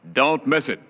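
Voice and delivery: ordinary talk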